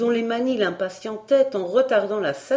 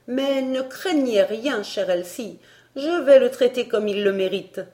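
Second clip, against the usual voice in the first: higher, determined declaration; indignant resolve; 6 semitones higher, 5 dB louder than usual. Acted. Barbara vowing to Elsie to confront him, resolute. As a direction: promise firmly to deal with him.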